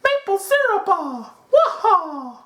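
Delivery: very high cartoon Italian plumber voice; Mario. Host parodying his own maple syrup line, high Mario voice. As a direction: squeal it out like a video game hero. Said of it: "it sounded like Mario".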